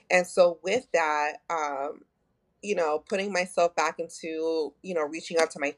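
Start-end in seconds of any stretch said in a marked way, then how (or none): none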